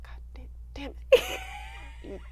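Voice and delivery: unvoiced whispered curse. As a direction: hiss the curse under your breath. Retold caught not watching.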